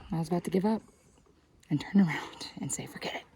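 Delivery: whispering. Real, not acted. Hushed aside on the summit.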